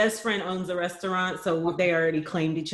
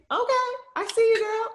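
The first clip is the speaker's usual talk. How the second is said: high, quick, amused reaction; playful mock approval. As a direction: pitch it way up, teasing a small daughter. Playful reaction to her daughter.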